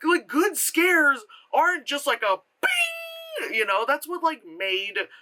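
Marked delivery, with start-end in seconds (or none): 2.6-3.6 very high, long ringing "bing"; imitated stinger. Reviewer mocks cheap loud scares, imitated stinger. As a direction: stretch out a mimicked jump-scare sting. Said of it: "It's 'cause it was loud".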